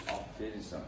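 quiet, gentle soothing of a scared dog; soft reassurance. Real, not acted. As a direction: hush the dog with soft repeats. Vet calming a frightened dog.

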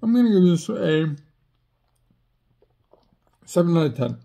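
words muffled by food; talking while chewing. Real, not acted. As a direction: mouth partly full. Seen 0: cracker eaten at -7; chewing lips and cheeks while talking.

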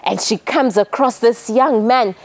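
ordinary delivery

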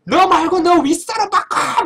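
high, slow demand for a manager; demanding shout. Retold or imitated. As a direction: bark the order. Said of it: "막 이러면서 막 그러더라고".

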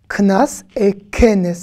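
Knas et kenes said very slowly, knas with no vowel, kenes with an e; contrasting pair. Shown as a demonstration. Teacher slowly contrasting two similar words for learners. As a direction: draw out Knas et kenes to show the extra e. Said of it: "deux mots qui se ressemblent".

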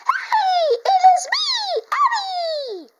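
very high, squealing puppet voice; Eddie the Backpack. Pretend. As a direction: burst out in a squeaky, loud character voice. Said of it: "Eddie the Backpack, come to life".

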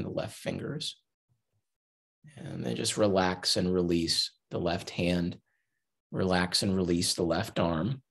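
unhurried body-scan guidance with long pauses; guided meditation. Acted. Teacher guiding listeners to relax hand and leg, guided meditation. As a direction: lead them gently through relaxing, phrase by phrase.

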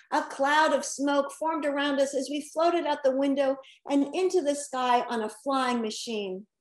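ordinary delivery

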